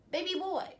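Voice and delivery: mocking the ex with a high, sassy pet name. Real, not acted. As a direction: talk down to him sweetly and high. Sassy address to ex.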